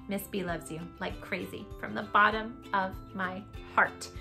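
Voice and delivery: ordinary delivery, nothing marked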